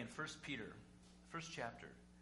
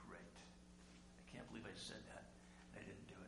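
whispered regret; 4 semitones lower, 12 dB quieter than usual. Acted. Peter after denying Jesus, ashamed whisper. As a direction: breathe it out in shame, barely audible.

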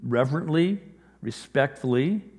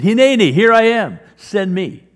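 much louder, higher, eager; confident volunteering; 7 semitones higher, 11 dB louder than usual. Retold or imitated. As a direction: step forward boldly to answer God. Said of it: "this burst of confidence".

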